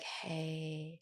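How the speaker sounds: hushed, soothing greeting to a stressed partner; soft voice. Retold or imitated. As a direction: say "Hey." very softly and gently, like a calming partner. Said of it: "you come in with that voice".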